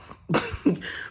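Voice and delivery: high, squeaky burst of laughter; real quick laugh; short cackle. Real, not acted. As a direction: let out a brief high cackle. Laughs at his own question.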